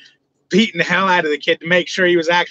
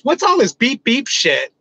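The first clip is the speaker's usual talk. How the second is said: high, indignant woman's voice; mock girlfriend; 7 semitones higher than usual. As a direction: squeak it out, offended. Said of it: "And she goes".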